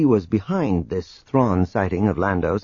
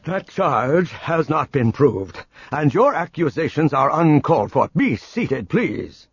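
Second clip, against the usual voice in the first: stern; 5 semitones higher than usual. A narrator's reading. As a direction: cut them off with authority. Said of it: "Gaverson broke in sternly".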